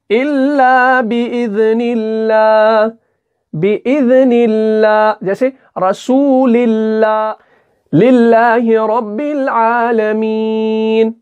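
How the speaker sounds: slow Quranic recitation with the लाम of अल्लाह kept thin; thin lam in Allah. Shown as a demonstration. Teacher shows students how to recite बिइज़निल्लाह, slow chanted recitation. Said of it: "कैसे?".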